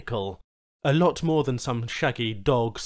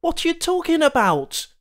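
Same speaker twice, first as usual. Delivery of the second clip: shocked; 12 semitones higher, 5 dB louder than usual. Acted. Charl's shocked outburst.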